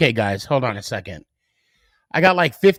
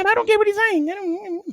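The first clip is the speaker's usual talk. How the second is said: squeaky chipmunk voice, much higher. Real, not acted. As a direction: talk like a pitched-up cartoon chipmunk. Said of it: "You literally sound like Alvin and the Chipmunks".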